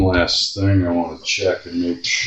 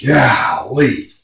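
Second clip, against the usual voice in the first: high, loud outburst of dismay; exasperated exclamation; 8 semitones higher, 6 dB louder than usual. Real, not acted. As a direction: burst out in exasperation. Reacting to oily, dirty cavity.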